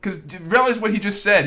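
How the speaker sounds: usual speaking voice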